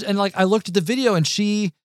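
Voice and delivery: ordinary talk, nothing marked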